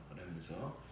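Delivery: usual speaking voice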